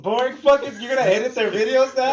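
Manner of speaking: high, loud, laughing disbelief; incredulous laughing outburst. Real, not acted.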